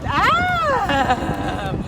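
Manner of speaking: long swooping cry, rising then falling; showy "ah". Pretend. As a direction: stretch it out as the trick flies. Performer voicing her trick.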